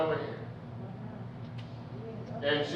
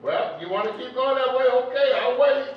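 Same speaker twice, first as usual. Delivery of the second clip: much higher and louder, patient and inviting; a raised, coaxing God voice; God speaking; 5 semitones higher, 4 dB louder than usual. Acted. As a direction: play God holding out his hand, willing to wait.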